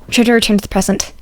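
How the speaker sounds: plain reading voice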